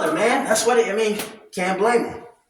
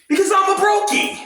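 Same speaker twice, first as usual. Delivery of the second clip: joking self-mock shout; 9 semitones higher than usual. Real, not acted. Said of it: "self mockery".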